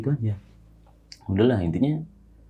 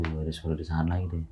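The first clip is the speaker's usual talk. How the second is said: hushed retold alert; 2 semitones lower, 10 dB quieter than usual. Friend telling tentmate about noises in the night, hushed. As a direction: repeat the discreet alert quietly.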